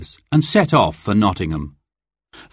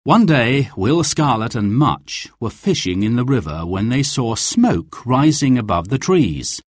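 ordinary delivery

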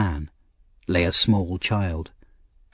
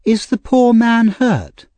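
put-on high, slow, gentle young lady's voice; sweet young lady; 13 semitones higher, 5 dB louder, slower than usual. A narrator's reading. Young lady at the top of the stairs asking, sweet and slow. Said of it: "said the sweet voice of a young lady".

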